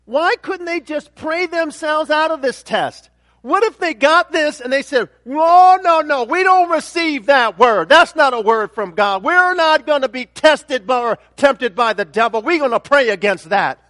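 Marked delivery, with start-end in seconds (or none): 5.3-13.8 loud Southern-accented mock protest; mock Southern preacher. Preacher imitates believers refusing the test, loud Southern accent. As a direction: refuse it like a fired-up Southern church member. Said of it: "sorry for the southern accent".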